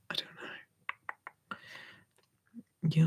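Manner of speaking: ordinary delivery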